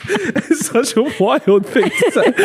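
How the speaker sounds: high, drawn-out words through real laughter; laughing. Real, not acted. Friend reacting to a wild dating line, laughing. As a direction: struggle to speak through the giggles. Helpless laughter at absurd phrase.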